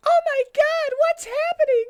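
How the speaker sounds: very high, loud panicked squeal; startled shriek. Acted. Amy startled by the attack, very high loud shriek. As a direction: shriek it in sudden alarm. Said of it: "said Amy, who was startled hard".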